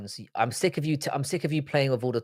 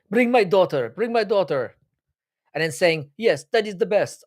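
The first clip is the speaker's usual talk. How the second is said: higher-pitched mock fighter accent, loosely done; imitating Cap's accent; 6 semitones higher, 3 dB louder than usual. Streamer mimics the fighter talking to the crowd, in a higher mock accent. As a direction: parrot the fighter's odd accent, half-joking. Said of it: "I don't know what that accent was".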